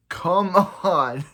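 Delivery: laughing, high and loud come on; loud exasperated laugh. Real, not acted. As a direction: throw up your hands, laughing in disbelief. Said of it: "exasperated at the show".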